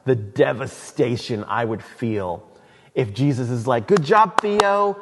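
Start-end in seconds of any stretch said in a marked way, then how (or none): 3.9-5.0 praise. Pretend. Imagined Jesus praising him.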